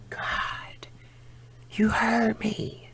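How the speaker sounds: soft, slow grateful whisper; whispered prayer. Retold or imitated. Woman whispering thanks to God for her answered prayer.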